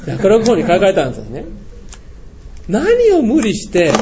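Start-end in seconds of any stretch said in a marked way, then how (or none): 2.7-3.9 叱りつける口調. Retold or imitated. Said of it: "いつものように言ってやったのね".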